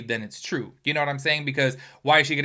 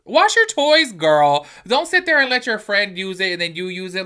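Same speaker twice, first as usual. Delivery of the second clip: put-on much higher and louder, scolding a careless girl; exasperated yell; 7 semitones higher, 10 dB louder than usual. Scolding a friend about sharing toys, loud exasperated yell. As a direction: yell the advice like a fed-up big sister. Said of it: "'cause I was like".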